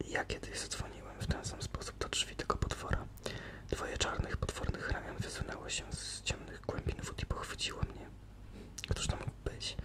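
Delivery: hushed storytelling whisper; whispered narration. A narrator's reading. Narrator telling the captives' plight in a whisper. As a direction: breathe the tale in a tense hush.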